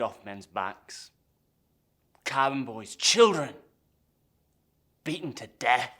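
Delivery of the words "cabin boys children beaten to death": put-on loud outburst peaking on "children"; outraged. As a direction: hurl out each horror in higher, louder bursts. Outraged at cruelty he witnessed.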